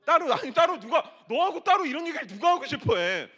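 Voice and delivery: loud, high mock outrage at the crowd; loud teasing. Real, not acted. Pastor teasing the youth, loud and high.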